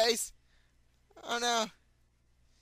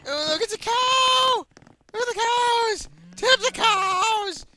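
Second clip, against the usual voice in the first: much higher, louder shouting about cows; excited shout; 14 semitones higher, 9 dB louder, slower than usual. Real, not acted. Gamer spotting cows, shouting gleefully. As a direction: yell with glee at the cows.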